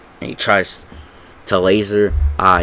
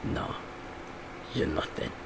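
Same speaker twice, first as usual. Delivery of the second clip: very soft, low dismissal; hushed, cold put-down; Butcher; 4 semitones lower, 18 dB quieter than usual. Acted. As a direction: say it under your breath, pausing after the first word. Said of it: "Butcher says".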